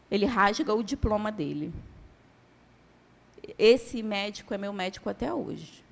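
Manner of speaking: ordinary talk, nothing marked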